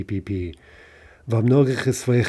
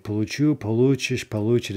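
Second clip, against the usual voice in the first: получу stressed at the end, получишь and получит on "лу"; shifting stress. Shown as a demonstration. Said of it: "That's our shifting stress pattern".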